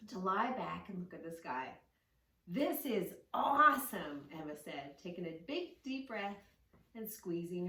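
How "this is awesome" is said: slow, loud, thrilled; delighted girl. A narrator's reading. Girl lying on the dock with her cousin, thrilled and loud. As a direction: savour it, drawn out and louder.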